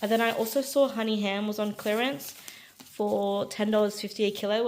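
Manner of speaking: usual speaking voice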